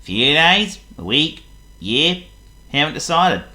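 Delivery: loud, high, cheery Clumsy voice; a careless guest answering with a happy grin; grinning guest. Acted. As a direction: grin through it, carefree. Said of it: "grinned Mister Clumsy".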